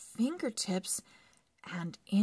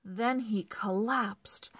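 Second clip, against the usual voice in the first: ordinary delivery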